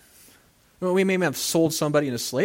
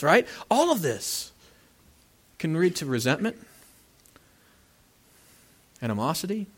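ordinary delivery

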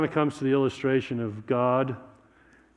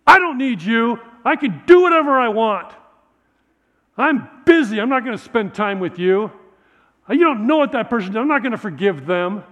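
high, loud, bratty shouting; a kid shaking his fist at God; defiant child yelling; 7 semitones higher, 9 dB louder than usual. Acted. Mark defiantly rejects God.

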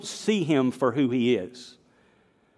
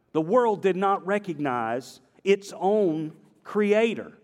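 ordinary delivery

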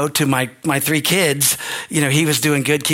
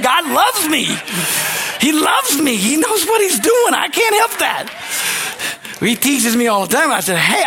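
higher, louder preaching shout; full-force preaching; 9 semitones higher, 3 dB louder than usual. Acted. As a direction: shout out God's love to the church. Preaching climax about God's love.

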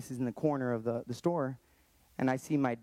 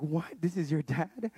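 lightly imitated shocked disbelief, higher; mock-stunned double take; 4 semitones higher than usual. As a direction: retell a stunned father-in-law asking, mildly. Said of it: "He was, like, shocked".

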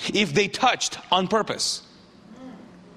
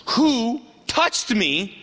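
each word stretched out, slightly raised; slow, weighty question; 3 semitones higher, much slower than usual. Acted. Jesus halting in the crowd to ask, slow and deliberate. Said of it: "asked Jesus".

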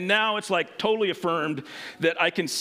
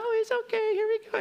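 soothing instructor. Retold or imitated. Smiling instructor soothing child.

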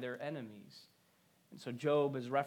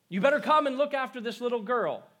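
loud, high urgent call for the mother; 8 semitones higher, 9 dB louder than usual. Retold or imitated. Father summoning mother to the hurt child, calling out loudly. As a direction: call out sharply as an alarmed father. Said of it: "he called the mother to come".

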